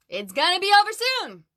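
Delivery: high, loud, excited reveal; excited announcement. Real, not acted. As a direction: burst out with the good news. Announcing the good news.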